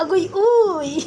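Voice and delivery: slow giggling "agoy, uy"; giggly squeal. Real, not acted. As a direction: tease through a laugh. Giggly teasing, laughing.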